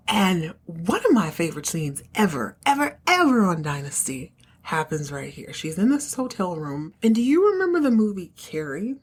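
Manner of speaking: ordinary talk, nothing marked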